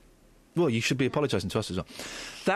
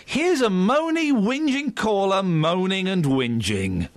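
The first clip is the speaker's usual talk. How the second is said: slow, louder show-host intro; hammy radio announcement; 6 semitones higher, 5 dB louder, much slower than usual. Acted.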